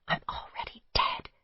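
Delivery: breathless whispered mantra; whispering "I'm already dead" to herself. A narrator's reading.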